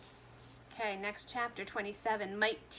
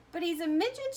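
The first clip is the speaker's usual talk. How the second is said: higher, loud shocked shout; 7 semitones higher, 5 dB louder than usual. A narrator's reading. Father shocked at his tiny son, loud shout. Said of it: "shouted Mister T V".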